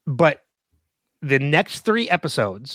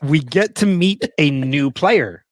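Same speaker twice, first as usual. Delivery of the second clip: slow, deliberate restart; spaced-out, insistent delivery; pointedly ignoring; slower than usual. Real, not acted. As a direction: press on word by word over the teasing. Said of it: "I'm avoiding what you're saying".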